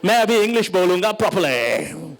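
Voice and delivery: put-on Hindi in an overblown colonial British accent; mock-proper English accent. Mocking English-obsessed Indians, mock-colonial English accent. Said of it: "Like you had a javelin up your ass".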